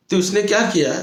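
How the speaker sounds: usual speaking voice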